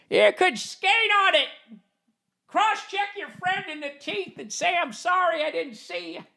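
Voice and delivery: put-on a cranky old man bragging about the good old days; old grandpa. Future grandpa telling his grandkids about frozen ponds, a high creaky old-man voice. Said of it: "And I'll be like".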